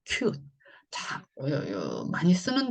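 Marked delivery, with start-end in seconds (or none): 0.0-0.4 큐트 in one syllable, "kyoot"; original English pronunciation. Shown as a demonstration. Teacher repeating the closer-to-original reading, original English pronunciation. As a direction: end 큐트 on a bare t. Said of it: "더 오리지널 발음하고 가까워요".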